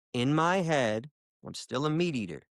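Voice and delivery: ordinary delivery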